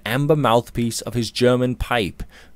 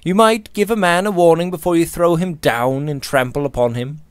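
high-pitched, civil protest; put-out but courteous complaint; mild remonstrance; 7 semitones higher, 3 dB louder than usual. A narrator's reading. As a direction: politely chide someone who bumped you, voice lifted. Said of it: "Robert remonstrated mildly".

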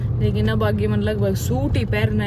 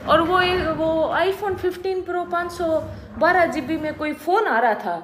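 angle for a gift in a raised pitch; coaxing request; 7 semitones higher than usual. Real, not acted. Wife asking husband to buy her a phone, coaxing.

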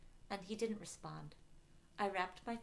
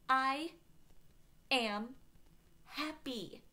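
"I", "am", "happy" each set apart by pauses; higher, punching "I" and "am" separately; emphatic insistence; 7 semitones higher, 4 dB louder, much slower than usual. A narrator's reading.

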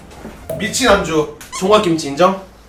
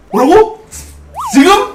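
mock surprise; 13 semitones higher, 5 dB louder, slower than usual. Retold late-night call invite.

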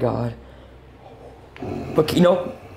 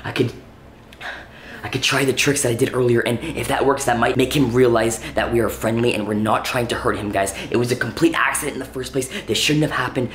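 usual speaking voice

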